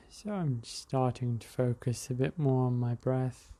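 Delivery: calm breathing guide talking the listener through; guided meditation. Acted. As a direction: ease the listener into the breath, unhurried.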